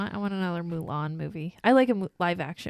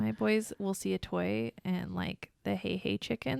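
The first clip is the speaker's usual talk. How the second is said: ordinary delivery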